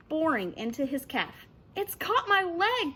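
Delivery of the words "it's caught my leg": high, slow anguished scream; boy screaming as the shark grips; screaming in pain. A narrator's reading. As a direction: cry out as it bites. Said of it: "Chet screamed".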